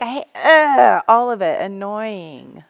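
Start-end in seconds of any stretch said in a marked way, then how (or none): 0.0-1.0 mock disgust. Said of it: "you could just look around and be like".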